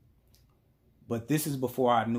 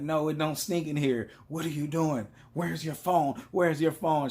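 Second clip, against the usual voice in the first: put-on slightly raised, accusing girlfriend voice; mock interrogation; 5 semitones higher than usual. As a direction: imitate a suspicious girlfriend's questioning. Girlfriend interrogating him, retold.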